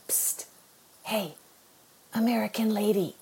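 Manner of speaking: hushed psst and a soft call in the dark; whispered call. Retold or imitated. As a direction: whisper it so the guards can't hear. Whisper in dark prison cell.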